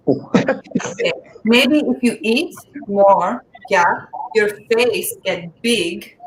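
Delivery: put-on slow, deadpan Persian-accented mom. Mother answering her daughter's nose question in a slow Persian accent. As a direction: deliver it slowly as a blunt Persian mother. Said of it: "Let me ask my mom".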